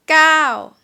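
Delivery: loud gao, rising then falling. Shown as a demonstration. Teacher counting six to ten, loud falling gao. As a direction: punch gao and drop it. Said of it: "falling tone".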